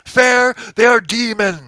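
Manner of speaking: high, loud scoff; indignant priest. Acted. Priest scorns the knight's call for fairness, indignant. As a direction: spit the word back in outrage.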